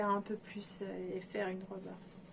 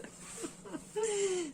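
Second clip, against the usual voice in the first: high giggle; 10 semitones higher than usual. Real, not acted. Laughs over the same fabric.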